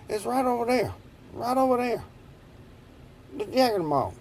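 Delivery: high, nervous explaining to the cop; nervous pleading. Retold or imitated. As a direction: talk fast and high like a scared kid caught by police. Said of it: "I was just".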